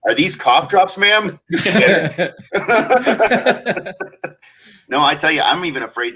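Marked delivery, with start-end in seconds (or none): 0.1-1.4 mock clerk. Mock store clerk question.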